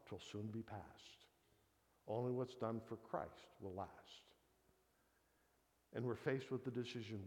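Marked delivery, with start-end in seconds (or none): 0.1-4.2 slow reverent recitation. Retold or imitated. Quoting the old saying reverently.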